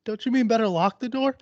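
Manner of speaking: high put-on woman's voice; scared woman. Frightened woman correcting the lyric from the closet, high-pitched. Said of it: "like, scared".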